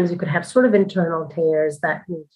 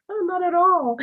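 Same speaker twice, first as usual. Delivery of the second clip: reassuring husband; 12 semitones higher than usual. Retold or imitated. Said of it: "my husband and the doula are like".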